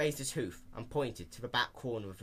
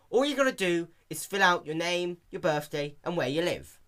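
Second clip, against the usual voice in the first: louder, higher spelled-out explanation; patient Dash; 7 semitones higher, 7 dB louder than usual. A narrator's reading. Dash explaining the form to the old pony, louder and clear.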